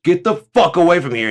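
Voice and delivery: very high, loud furious shout; father driving agents off his land, screaming; angry dad yelling. Retold or imitated. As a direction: scream him off the lawn. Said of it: "he goes".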